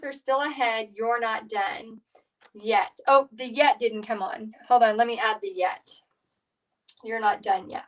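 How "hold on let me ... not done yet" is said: ordinary talk, nothing marked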